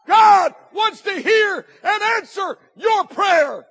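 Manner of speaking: loud, high, slow shouted preaching; fiery revival preacher crying out; preaching at full force. Acted. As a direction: build the call to keep praying into a roar. Preaching climax to congregation.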